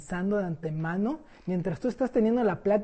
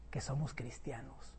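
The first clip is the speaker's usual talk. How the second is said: whispered aside; 7 semitones lower, 17 dB quieter than usual. Real, not acted. Sad aside about hidden faith.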